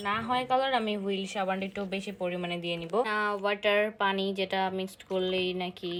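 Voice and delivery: ordinary talk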